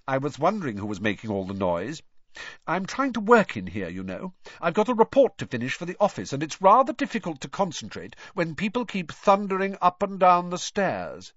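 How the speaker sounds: a hint of a self-important young official; lightly voiced pompous older brother. A narrator's reading. Older brother scolding the noisy boys on the stairs, pompous. Percy complaining at the door.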